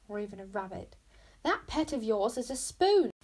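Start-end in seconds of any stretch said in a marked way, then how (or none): 1.4-3.1 louder, higher-pitched protest; indignant objection from a rule-follower; objecting. A narrator's reading. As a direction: object loudly, landing on the last word. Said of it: "objected Bodkin".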